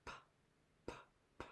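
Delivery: "p" as a short voiceless puff; teacher voicing the letter p; letter sound p. Shown as a demonstration. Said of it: "So we know P says".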